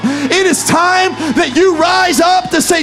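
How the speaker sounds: loud, rising pulpit preaching; full-force preaching. Real, not acted. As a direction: preach it hard and build to a roar.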